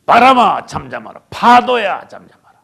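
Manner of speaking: loud command to wind and waves; acted rebuke. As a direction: order the storm to be still, punching each name. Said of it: "막 꾸짖은 거예요". (바람과 파도를 큰 소리로 꾸짖듯 명령)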